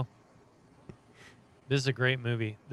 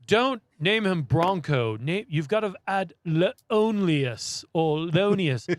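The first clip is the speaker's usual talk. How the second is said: mock pompous writer; 4 semitones higher, 3 dB louder, much slower than usual. Quoting the writer character.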